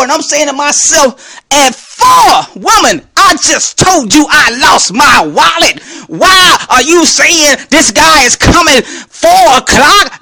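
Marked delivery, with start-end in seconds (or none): 1.5-10.2 high, outraged retelling; incredulous, shouted-out complaint; wrathful outburst. Retold or imitated. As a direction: act out furious disbelief, hammering the words. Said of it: "feel the wrath I had in me".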